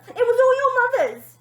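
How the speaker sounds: high, loud stammering fright; frightened splutter. Acted. Scared father blaming the mother, high and loud. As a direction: stammer out the excuse.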